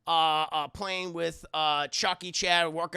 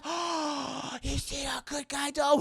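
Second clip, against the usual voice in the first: higher, put-on kid voice; wide-eyed small child, pitched up; little boy voice; 7 semitones higher, slower than usual. Andy gasps at the doll his mother brings home, in a higher kid voice.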